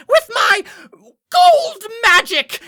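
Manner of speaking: high, loud, drawn-out spell cry; grandiose fiend imp. Acted. GM as fiend imp casting at assassins, pompous shout. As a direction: build to a hammy villain's big reveal. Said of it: "avarice imp stands and will say".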